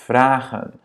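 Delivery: VRAGEN with a long, open AA; teacher's model VRAGEN, A drawn out; long vowel. Shown as a demonstration. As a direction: hold the first vowel of VRAGEN. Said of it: "prononcée de manière longue".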